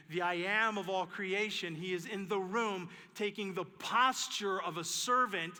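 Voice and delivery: loud, slow, emphatic preaching; preaching at full force. Acted. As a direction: drive it home to the congregation.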